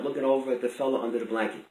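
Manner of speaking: ordinary delivery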